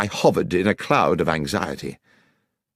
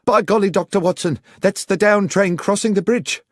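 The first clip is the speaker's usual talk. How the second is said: high, louder startled exclamation; alarmed station master; 11 semitones higher, 4 dB louder than usual. A narrator's reading. Fraser warning Watson about the down train, alarmed high exclamation. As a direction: blurt it out in alarm. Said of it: "and Fraser said".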